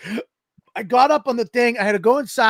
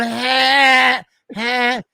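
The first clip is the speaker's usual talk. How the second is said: mock helpless moan, pitched up; pained moan; 4 semitones higher than usual. Husband fallen on the ice, moaning in pain. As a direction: groan as if winded on the ground. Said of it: "You heard me moaning".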